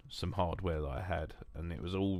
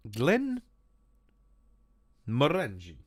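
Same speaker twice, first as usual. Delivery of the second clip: put-on Italian accent, pitched up high at the start; 6 semitones higher, 6 dB louder, slower than usual. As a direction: say it with a playful Italian lilt. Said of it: "did that in an Italian accent".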